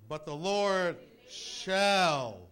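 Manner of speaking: very slow, booming scripture cry; preaching declamation. Real, not acted. Preacher promising deliverance to the congregation, loud drawn-out proclamation. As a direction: stretch each word out at full force.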